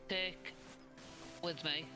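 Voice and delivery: ordinary talk, nothing marked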